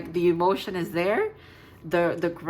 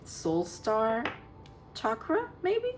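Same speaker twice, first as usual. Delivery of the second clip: unsure guess; 5 semitones higher, 4 dB quieter, slower than usual. Real, not acted. Unsure of the card's name.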